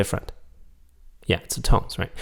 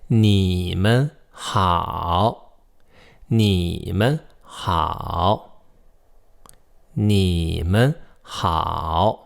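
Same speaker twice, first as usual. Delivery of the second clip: very slow, drawn-out syllables; much slower than usual. Shown as a demonstration. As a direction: stretch each syllable to show the tones. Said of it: "I'm gonna slow down".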